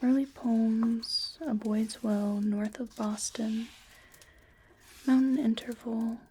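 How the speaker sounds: ordinary talk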